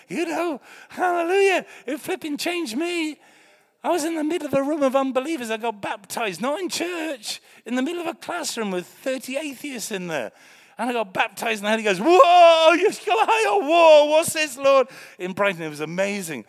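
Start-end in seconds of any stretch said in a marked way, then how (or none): 0.1-12.0 much higher and louder, testifying with fire; fiery preaching. Acted. Preaching testimony at full force. 12.0-14.9 very high and loud, overwhelmed whoa; ecstatic cry. Retold or imitated. Retold being filled with Spirit.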